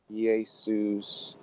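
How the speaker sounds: "Jesus" with a y glide and long oo ending; slow Greek "ee-ay-SOOS" for Jesus; Greek pronunciation. Shown as a demonstration. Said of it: "in the Greek, it would be pronounced Iesus".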